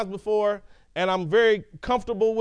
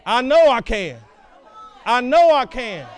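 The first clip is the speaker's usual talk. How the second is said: loud, firm certainty; confident engine; 2 semitones higher, 6 dB louder than usual. Retold or imitated. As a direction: declare it like you've already won. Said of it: "get to the point to where I know".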